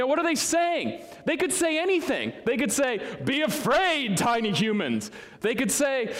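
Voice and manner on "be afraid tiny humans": mock menacing angel. Pastor mocking what angels could say, hammed-up menacing angel. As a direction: ham it up like a scornful giant angel.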